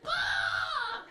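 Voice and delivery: high, wailing cry of despair; mock wailing kid. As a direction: wail like a kid losing his candy. Kid wails over dropped candy.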